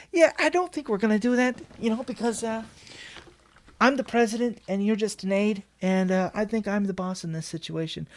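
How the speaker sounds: high Trump voice, dismissive; Trump impression. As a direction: wave it off like Trump. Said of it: "that Trump voice".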